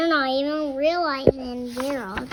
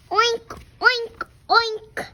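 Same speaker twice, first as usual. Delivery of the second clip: higher playful oink oink oink; pig oinks; 8 semitones higher, 4 dB louder than usual. Pretend. Reading the pig book, playfully oinking as the pig.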